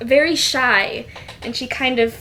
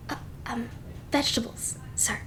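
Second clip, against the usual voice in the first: "vegetables," said softly and timidly, much quieter; timid prompt; 13 dB quieter, faster than usual. Acted. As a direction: offer "vegetables," almost under your breath. Maid prompts the Duke.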